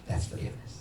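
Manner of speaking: whispered, unvoiced. Real, not acted. Pastor names the point, whispering. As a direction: breathe it out softly. Hushed punchline.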